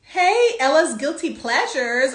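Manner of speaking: normal talking voice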